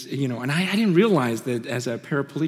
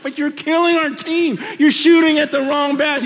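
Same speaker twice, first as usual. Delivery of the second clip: high, loud, exasperated protest; mock-yell it at a ball hog, pitched up high; exasperated teammate; 11 semitones higher, 5 dB louder than usual. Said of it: "You're like".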